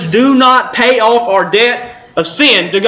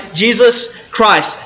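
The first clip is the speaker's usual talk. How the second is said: normal talking voice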